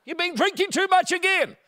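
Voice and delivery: high, loud shouted accusation; hammed-up shrieked retort; mock yelling back. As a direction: holler it over the fence. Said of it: "yelling back".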